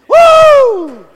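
a sudden high, loud whoop of joy; excited whoop. Real, not acted. Pastor whooping after the sermon climax. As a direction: let out a celebratory holler.

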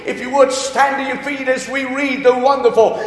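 loud, ringing preacher's cry, building; preaching at full force. Acted. Pastor rousing the congregation, loud preaching. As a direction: proclaim it to the whole church. Opening prayer and Scripture exaltation.

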